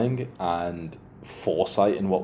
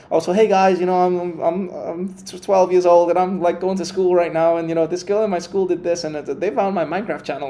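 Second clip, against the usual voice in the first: put-on higher, louder kid YouTuber voice; chatty twelve-year-old talking to camera, pitched up; young vlogger; 9 semitones higher, 4 dB louder than usual. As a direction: play an excitable schoolkid vlogging. Imitates a young vlogger as example.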